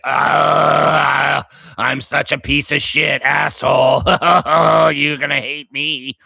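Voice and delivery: loud phlegmy wrestling-heel growl; evil villain voice. Retold or imitated. Host impersonating a hated executive as a wrestling villain, phlegmy and loud. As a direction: snarl it like a gross evil boss. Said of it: "I make him sound like Vince McMahon".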